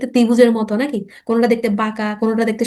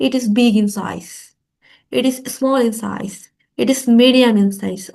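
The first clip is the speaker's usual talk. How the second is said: slow teaching; much slower than usual. Shown as a demonstration. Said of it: "কিভাবে বলবো?".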